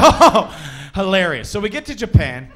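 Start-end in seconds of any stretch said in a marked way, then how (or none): 0.0-1.5 laughing. Retold or imitated. Reacts laughing to the joke.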